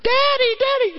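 put-on very high child voice; child's call. The kids greet their father, high child call. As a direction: call out like a little kid.

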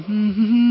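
long drawn-out wordless hum; toddler hum imitation. Grandpa showing how his granddaughter goes, imitated hum. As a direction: imitate a grandbaby humming along. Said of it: "you know, Nora going".

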